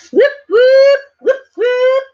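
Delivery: very high, loud whooping cheer; celebratory whoop. Real, not acted. Celebrating the finished wolf for her viewers, high loud whoops. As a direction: cheer like you just won.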